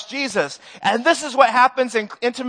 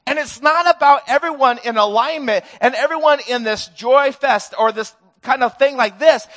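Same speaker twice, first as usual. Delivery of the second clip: normal talking voice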